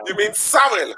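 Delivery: exaggerated Jamaican "sorrel" with a w for the r; Jamaican pronunciation. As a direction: play the puzzled Jamaican, lifting "sorrel" into "sah-wil". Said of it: "if you go into Jamaica".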